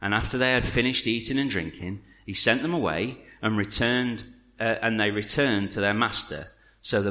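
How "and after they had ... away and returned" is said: ordinary delivery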